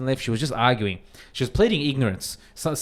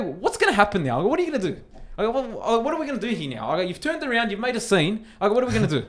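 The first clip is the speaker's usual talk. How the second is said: confrontational challenge; 9 semitones higher, faster than usual. Retold or imitated. His retold challenge in quarrel.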